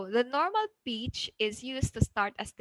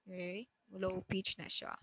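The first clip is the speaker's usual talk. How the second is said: dropped low and much softer; very low pitch; 3 semitones lower, 16 dB quieter than usual. Shown as a demonstration. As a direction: demonstrate a low, quiet pitch level. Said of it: "that's a very low pitch".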